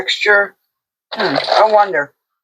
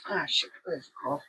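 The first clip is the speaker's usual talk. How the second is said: unvoiced muttering to herself; whispered aside; 18 dB quieter, much faster than usual. Real, not acted. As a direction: whisper it while searching. Muttering to herself while searching.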